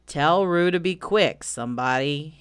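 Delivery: put-upon donkey grumble; gloomy Eeyore. Acted. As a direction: plead slowly in a glum drawl.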